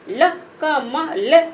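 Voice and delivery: very slow, each syllable of ल का म ल drawn apart. Shown as a demonstration. Teacher sounding out syllables for a child, very slow. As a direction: spell it out slowly for a child. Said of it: "ला को धीरे से बोल रहे हैं".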